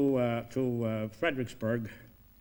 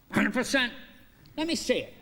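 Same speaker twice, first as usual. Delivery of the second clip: eager; 10 semitones higher than usual. Retold or imitated. Lincoln eager to see dance.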